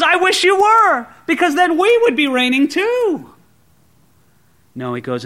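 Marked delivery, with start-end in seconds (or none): 0.0-3.3 high, loud mocking wish; sarcastic. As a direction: needle proud listeners with mock envy. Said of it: "[name] is using some strong sarcasm".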